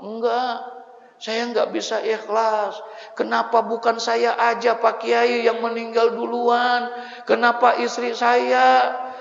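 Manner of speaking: high, loud anguished wailing; wailing grief. Retold or imitated. Husband wailing to the preacher over his dead wife. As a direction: cry out in despair over the wife. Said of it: "Nangis, nangis, nangisnya".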